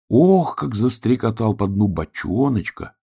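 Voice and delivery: plain reading voice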